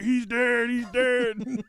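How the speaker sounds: high, loud, repeated cries of panic; imitated freaking out. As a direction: play a horrified driver losing it. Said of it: "This dude's freaking out".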